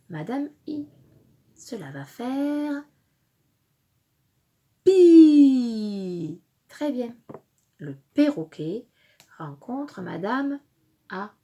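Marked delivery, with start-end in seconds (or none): 4.8-6.4 loud, stretched "pi" sliding downward; drawn-out syllable. Shown as a demonstration. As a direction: hold "pi" on a long falling glide. Teacher blends letters into syllable.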